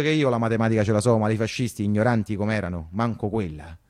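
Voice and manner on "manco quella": ordinary delivery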